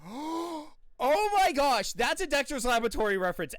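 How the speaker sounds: high, loud excited recognition; thrilled fan discovering a reference; excited gasp. Retold or imitated. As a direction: blurt it out in delight. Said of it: "And I was like".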